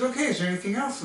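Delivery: quick, high waitress voice; brisk waitress. Retold or imitated. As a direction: rattle it off like a busy waitress.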